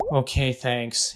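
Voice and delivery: slow, grudging, reluctant reply. Retold or imitated. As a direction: drag it out like a sulky child who doesn't mean it. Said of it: "she's still upset".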